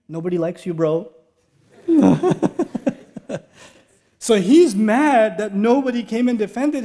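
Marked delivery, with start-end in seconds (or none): 1.9-2.9 high, bursting laugh; real laugh. Real, not acted. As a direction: laugh out at your own joke. Laughs after punchline.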